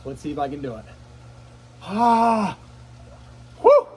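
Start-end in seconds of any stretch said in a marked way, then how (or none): 1.8-2.6 loud sharp gasp at the cold; cold-shock gasp. Real, not acted. Entering icy water.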